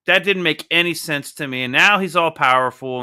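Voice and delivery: ordinary talk, nothing marked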